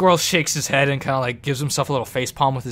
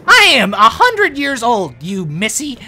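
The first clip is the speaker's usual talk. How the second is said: offended, pitched-up gnome; indignant gnome; 10 semitones higher, 7 dB louder than usual. Acted. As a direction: protest hotly as an affronted little gnome. Gnome offended at being called child.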